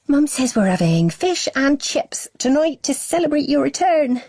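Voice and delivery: put-on high, eager child voice; chatty little girl. A narrator's reading. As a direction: tell him the news like a happy little girl. Little sister chatters to brother.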